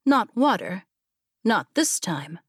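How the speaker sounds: ordinary delivery, nothing marked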